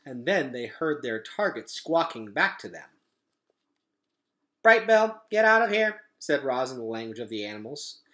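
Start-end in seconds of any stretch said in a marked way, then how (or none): 4.6-6.0 louder, higher urgent call; urgent warning. Acted. Roz warning her goose son to flee, urgent call. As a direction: warn him off fast.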